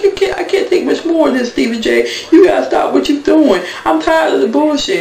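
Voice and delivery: mock whiny Mimi pitched far up, with a laugh; fed-up Mimi. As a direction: imitate her pleading, high and exasperated. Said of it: "She's like".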